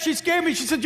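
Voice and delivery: ordinary delivery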